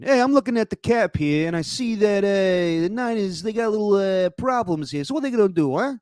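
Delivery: high-pitched, hammed-up Italian-American sportscaster impression; Dan Graziano. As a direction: pitch it way up and caricature an Italian guy talking sports. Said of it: "I'll do my Dan Graziano impression".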